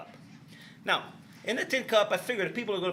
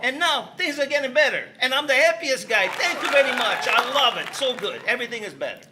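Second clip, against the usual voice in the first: excited; 7 semitones higher, 9 dB louder than usual. Acted. Happy ending to his story.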